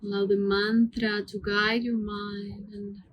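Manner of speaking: calm, hushed meditation voice with long pauses; guided meditation. Acted. Yoga teacher leading students through mantra breathing, soothing guided meditation. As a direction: guide the breath gently, word by unhurried word.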